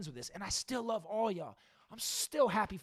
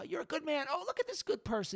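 mock admiring onlooker; 7 semitones higher than usual. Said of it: "it's like, uh".

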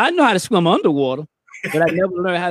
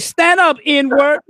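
higher, emphatic called-out order; 7 semitones higher, 4 dB louder, slower than usual. Retold or imitated. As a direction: imitate a bystander calling to a drowning man.

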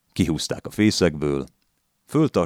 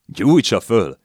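loud, higher jeering retort; loud interjection; 7 semitones higher, 8 dB louder, much slower than usual. A narrator's reading. Old man jeers at the landlady, loud and higher. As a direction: throw it in to provoke her. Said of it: "szólt közbe megint az öregember".

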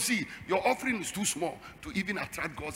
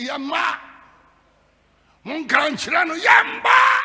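lightly voiced, higher defiant refusal; mock the stubborn members, raised; defiant church members; 7 semitones higher, 9 dB louder, slower than usual. Twi-speaking church members refusing the pastor, a hint of defiance. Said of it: "the way they say it in the church".

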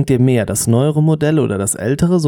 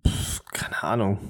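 whispered shrug; 3 semitones lower, 12 dB quieter than usual. Real, not acted. Whispered dismissive, laugh.

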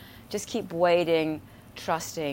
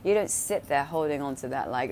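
usual speaking voice

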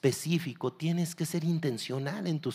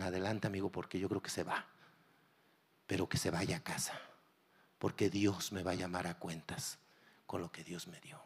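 low, soft bystander voice; quiet retold reply; 6 semitones lower, 9 dB quieter than usual. Bystander softly telling pastor to go ahead. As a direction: hand it over softly.

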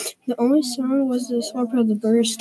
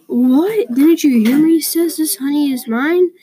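loud, higher-pitched indignant roar; storybook roar; 5 semitones higher, 5 dB louder than usual. A narrator's reading. Bear defends his honey from Mole, roaring. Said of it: "roared Bear".

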